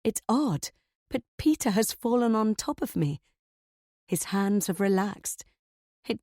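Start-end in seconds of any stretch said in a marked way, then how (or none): none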